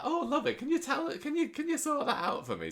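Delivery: high Audrey voice. Retold or imitated. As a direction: squeal it like a doting gran. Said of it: "she's like".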